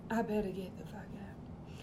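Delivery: hushed, imitated child's whisper; voiceless, secretive mutter; whispered aside. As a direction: whisper it to yourself as a scared kid. Child's frightened aside to self.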